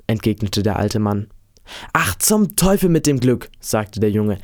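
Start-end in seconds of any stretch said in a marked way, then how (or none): 1.9-3.5 put-on higher-pitched defiant boy; impatient boy, lightly acted; mild outburst. A narrator's reading. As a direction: brush it off with mild vexation. Boy dismisses talk of luck.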